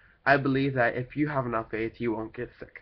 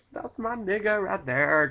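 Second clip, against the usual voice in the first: higher and slow, drawn-out praise; hyped clone voice; 8 semitones higher, slower than usual. Pretend. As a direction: stretch it out proudly like a hype man. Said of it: "then I would be like".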